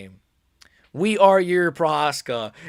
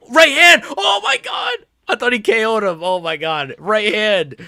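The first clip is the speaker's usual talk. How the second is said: excited shout; 7 semitones higher, 7 dB louder than usual. Real, not acted. Fight moment, big punch.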